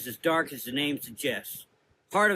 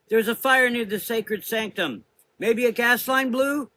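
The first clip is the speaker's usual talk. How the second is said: higher, louder anxious report; worried sister; 10 semitones higher, 7 dB louder than usual. A narrator's reading. A young sister reporting the fire, anxious. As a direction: report it nervously.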